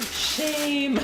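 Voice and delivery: drawn-out, high cry of condemnation; Game of Thrones nun. Acted. Streamer mimicking the shame nun for chat, high drawn-out chant. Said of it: "The nun from Game of Thrones".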